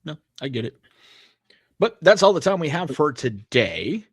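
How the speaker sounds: ordinary talk, nothing marked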